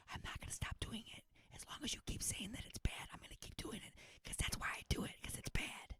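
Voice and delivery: quick, hushed stage whisper; defiant whisper. Pretend. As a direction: whisper it fast as a stubborn aside. Defiant aside about doing accents.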